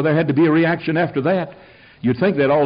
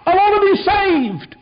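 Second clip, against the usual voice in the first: retold shout. Man in a revival meeting interrupting, high shouted plea. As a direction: call out high like a man jumping up mid-service. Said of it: "jump up in meetings and shout".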